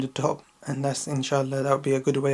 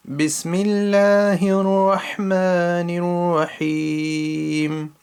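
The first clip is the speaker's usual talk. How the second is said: Quran recitation; 4 dB louder than usual. Shown as a demonstration. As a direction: recite each verse carefully as a teacher's model. Teacher recites Quran with tajweed.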